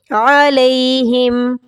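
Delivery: علیہم chanted slowly with the ay glide; correct aleihim. Shown as a demonstration. Teacher showing students the correct way. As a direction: recite علیہم slowly with the ay sound. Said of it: "اس کو علیہم نہیں پڑھنا".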